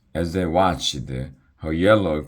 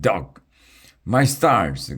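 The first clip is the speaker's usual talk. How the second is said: surprised cry; 5 semitones higher, 4 dB louder, slower than usual. A narrator's reading. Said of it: "cried the dragon twins".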